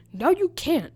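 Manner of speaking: teacher refusing the narrator, loud and high; snapping teacher. Retold or imitated. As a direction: shut it down fast.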